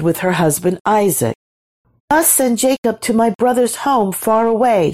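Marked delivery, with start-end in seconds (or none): 2.1-4.9 high, pleading mother's voice; high-voiced wifely appeal; urging. A narrator's reading. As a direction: press the husband, pitched well up. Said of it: "she urged him".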